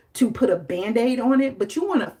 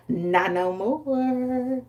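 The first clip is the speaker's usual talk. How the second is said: drawn-out taunt; 5 semitones higher, much slower than usual. Retold or imitated. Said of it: "and then she said".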